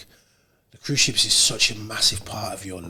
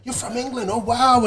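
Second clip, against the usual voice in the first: surprised local; 10 semitones higher than usual. Retold or imitated. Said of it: "And then they wanted".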